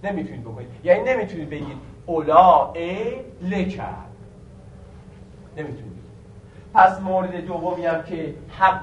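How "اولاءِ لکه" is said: اولا drawn out as olā-e, then لکه apart. Shown as a demonstration. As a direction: stretch اولا into a hamza before adding لکه. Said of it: "با حالت مد تلفظ بشه". («اولا لکه» با مد و همزه در «اولا»)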